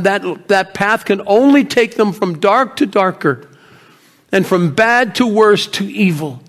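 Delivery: rebuking. Acted. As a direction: lecture him forcefully, driving through the list. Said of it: "she was rebuking me that day".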